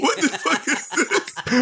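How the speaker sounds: slow, high, horrified What the fuck; shocked disbelief. Acted. Horrified captive in a game.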